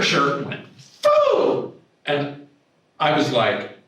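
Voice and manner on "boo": a sudden high, loud boo; spring it on them like a surprise; playful reveal. Retold or imitated. Client flashing her healed chest.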